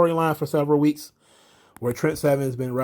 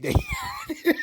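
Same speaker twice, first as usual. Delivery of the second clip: higher voice breaking into laughter; real, helpless wheezy laughing fit; cracking up; 4 dB quieter than usual. Real, not acted. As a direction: laugh through the end of the sentence. Cracking up at the retold line.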